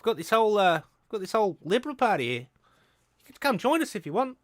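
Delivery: high, loud New Zealand accent put on. Mocking a nationalist in a loud, high New Zealand accent. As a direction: play it as a cocky New Zealand bloke. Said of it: "your New Zealand accent is".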